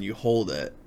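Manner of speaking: ordinary talk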